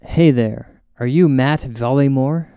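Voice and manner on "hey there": ordinary talk, nothing marked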